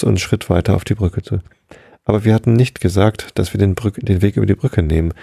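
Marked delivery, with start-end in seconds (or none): none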